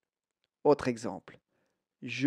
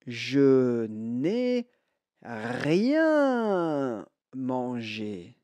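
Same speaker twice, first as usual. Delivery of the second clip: very slow, drawn-out model phrase; slow model sentence; 2 semitones lower, much slower than usual. Shown as a demonstration. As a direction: stretch each word out for learners to repeat. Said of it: "écoutez bien et répétez".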